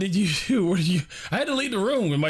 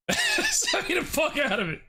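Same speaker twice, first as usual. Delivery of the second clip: laughing; 13 semitones higher than usual. Real, not acted. Streamer repeating his joke, laughing. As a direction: repeat it slowly through a high laugh.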